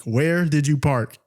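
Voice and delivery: "Where did you park" slowly, word by word; every syllable emphasized. Retold or imitated. Showing friend the dictated text, every syllable of "Where did you park" emphasized. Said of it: "I emphasized every single syllable".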